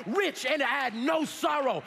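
impassioned, rising revival preacher; full-force preaching. Real, not acted. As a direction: drive each phrase home to rouse the congregation.

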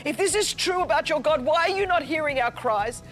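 usual speaking voice

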